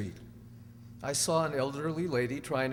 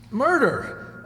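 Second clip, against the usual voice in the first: louder, higher cry of disbelief; aghast; 8 semitones higher, 8 dB louder than usual. Acted. Accused man repeating the charge, aghast. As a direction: blurt the word in horror.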